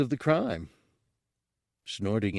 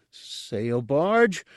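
higher, wide-eyed excited question; an eager creature's hopeful query; snuffling excitement; 5 semitones higher than usual. A narrator's reading. Guard hears of the barge trip, excited. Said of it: "as he snuffled excitedly".

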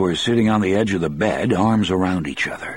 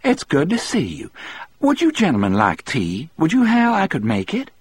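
cheery woman's voice, pitched high; cheerful; 10 semitones higher than usual. A narrator's reading. Melinda offers tea to her gentlemen visitors, cheerful. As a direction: play a delighted hostess, voice lifting high. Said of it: "said in a cheerful voice".

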